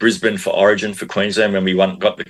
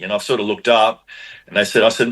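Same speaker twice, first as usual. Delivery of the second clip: ordinary delivery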